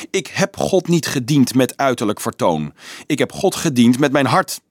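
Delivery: usual speaking voice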